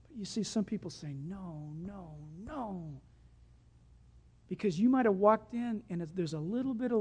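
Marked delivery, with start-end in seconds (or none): 1.1-3.0 low, soft, drawn-out no; quiet refusal. Retold or imitated. Some people resisting a commitment, quiet refusal. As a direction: refuse softly, dropping low.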